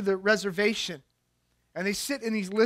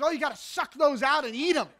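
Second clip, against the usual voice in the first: through a laugh, higher; laughing quote; 6 semitones higher than usual. Retold or imitated. Stranger urging them to eat the eggs, laughing. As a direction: chuckle out the eager advice. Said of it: "He goes".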